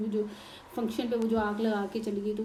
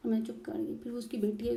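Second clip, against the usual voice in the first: ordinary talk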